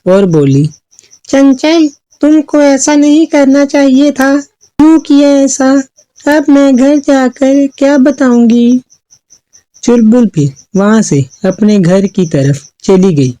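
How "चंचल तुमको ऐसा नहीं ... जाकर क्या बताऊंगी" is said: high-pitched, troubled character voice; worried Chulbul. A narrator's reading. Girl fretting over her vanished sister, worried high voice. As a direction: fret aloud in a raised voice. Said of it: "चुलबुल परेशान हो गई".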